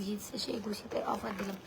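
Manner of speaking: breathy whispered aside to the viewers; joking whisper. Acted. Seller whispers a joke to her live viewers, whispering. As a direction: let the joke slip out in a whisper.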